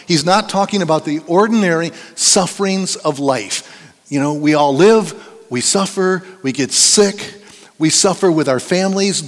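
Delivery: emphatic pulpit delivery, hammering key phrases; forceful preaching. Real, not acted. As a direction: drive the warning home to the congregation.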